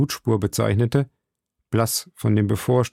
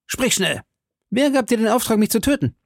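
put-on young D'Artagnan demanding; 11 semitones higher than usual. A narrator's reading. D'Artagnan questions bandit.

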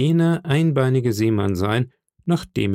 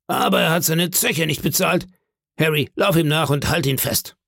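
slightly raised, higher landlord voice; Silver, indignant landlord; 5 semitones higher than usual. Acted. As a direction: order a man to give chase.

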